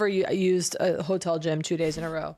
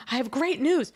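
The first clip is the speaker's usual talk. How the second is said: high-pitched mock elation; hammed-up happy announcement; very excited; 6 semitones higher than usual. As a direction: imitate an elated accountant with big news. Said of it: "why else would she be so excited saying".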